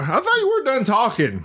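high-pitched teasing reprimand; mock scolding. Real, not acted. Co-host catching host who quit talking, mock scolding.

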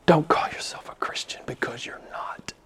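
whispered rebuke, fading away; hushed warning. Real, not acted. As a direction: drop suddenly to a grave whisper. Preacher drops to hush for effect.

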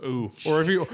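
normal talking voice

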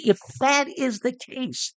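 a high proclaiming call to the multitude; shouting to the crowd. Retold or imitated. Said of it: "he shouts to the multitude".

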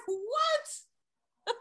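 very high, drawn-out stunned squeal; squealed disbelief. Retold or imitated. To the contractor naming Clark Gable, high stunned squeal. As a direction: let it crack up with delight.

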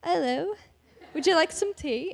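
playful try at British English; British accent. Retold or imitated. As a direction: attempt a British accent, offering tea. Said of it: "can do a very convincing British accent".

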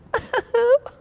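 high squealing baby laugh imitated; a grown man's high-pitched mock baby giggle. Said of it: "the baby goes".

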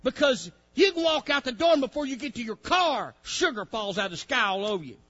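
loud, ringing pulpit preaching with high pitch; preaching at full force. Acted. Preacher rousing his congregation, loud full-force preaching. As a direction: preach it out to the whole church.

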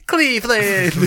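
high, loud laughing cheer; cheerleader. Pretend. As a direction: squeal it like a cheerleader. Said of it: "like a cheerleader".

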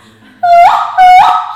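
very loud, high wordless call; high sustained call across the distance; calling back. Shown as a demonstration. Answering kokum across the bush, loud high call. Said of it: "So I would call back".

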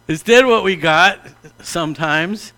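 usual speaking voice

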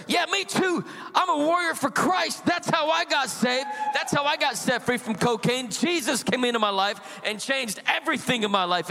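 fired up like a street preacher; high, eager, on-fire testimony; fervent stranger. Retold or imitated.

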